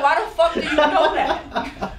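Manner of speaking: slow, laughing through "Why the fuck do you know that?"; half-laughing mock outrage; laughing disbelief. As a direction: drag out "fuck" while laughing. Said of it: "I'm like".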